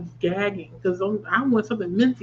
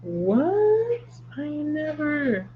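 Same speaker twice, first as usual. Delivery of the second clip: high, drawn-out astonished reaction; real amazement; 7 semitones higher, much slower than usual. Real, not acted. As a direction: stretch it out high in disbelief. Amazed by the candy she found.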